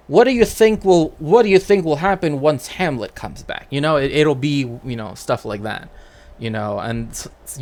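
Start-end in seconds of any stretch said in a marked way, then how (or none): 0.1-3.7 stage actor addressing audience. Retold or imitated. Actors asking audience mid-play.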